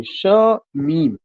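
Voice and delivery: Shamim drawn out with a stop after "sha"; pause between syllables. Shown as a demonstration. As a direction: let the voice halt mid-word in Shamim, sha ... meem. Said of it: "जहां हमारी आवाज naturally रुक जाती है".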